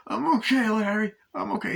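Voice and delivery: high, strained mock reply; pained compliance. Wounded man answering Larry's demand, high pained compliance. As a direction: give in, pitched high like someone hurt.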